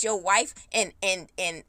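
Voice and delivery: normal talking voice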